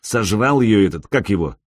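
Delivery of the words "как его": ordinary delivery, nothing marked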